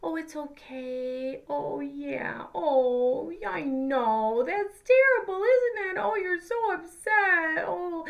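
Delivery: imitating a parent comforting an upset infant, high and tender; soothing baby talk. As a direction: coo to a crying baby, slow and high. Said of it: "we're like".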